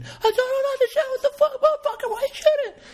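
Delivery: squeaky, laughing, frantic cop, pitched sky high; mock a cop losing it in a panic, way up high; cartoonish high-pitched panic, fast and laughing; hyperventilating cop.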